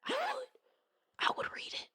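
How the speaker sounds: breathy confession; whispered guilty aside; secret whisper. Real, not acted. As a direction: admit it under your breath.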